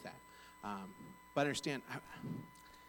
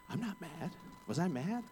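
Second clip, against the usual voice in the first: defensive denial; 7 semitones higher than usual. Retold or imitated. Husband denying to wife that he is mad, defensive. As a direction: retell it as comic self-doubt, pitched up. Denies being mad to concerned spouse.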